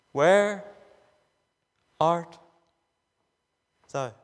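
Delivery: low, slow call with long pauses between the words; God calling. Acted. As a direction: let each word hang like God calling. Refrain in child scene.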